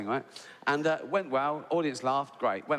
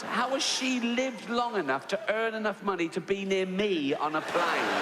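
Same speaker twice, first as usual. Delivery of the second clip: incredulous rant; 7 semitones higher than usual. Retold or imitated. Ranting about nut allergy passenger.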